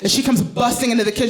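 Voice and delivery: usual speaking voice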